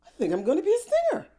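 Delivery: high, fast and bright with a laugh; giddy realization. Retold or imitated. Retelling her own mushroom revelation, excited and high. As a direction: blurt it out like a sudden epiphany.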